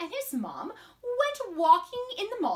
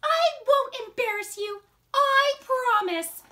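high storybook mother voice; promising mom; 10 semitones higher, 4 dB louder than usual. Acted.

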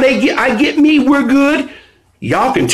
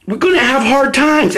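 ordinary talk

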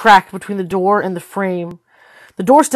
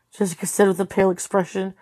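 plain reading voice

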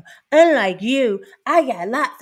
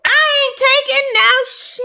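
high, loud, close to tears; impassioned cry; 8 dB louder than usual. Real, not acted. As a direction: cry it out with full conviction. Impassioned rant about self-respect.

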